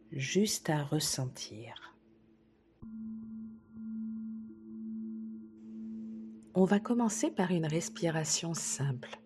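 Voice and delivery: slow, soft relaxation voice with long pauses; soothing sophrology session delivery, softly paced; guided meditation. Acted. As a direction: guide a listener gently through calm breathing, unhurried. Leads a breathing relaxation exercise.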